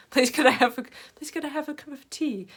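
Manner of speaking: high, exaggeratedly polite British voice; hammed-up British politeness. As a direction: ask it in a very British way, pitched high. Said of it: "like, a very British way".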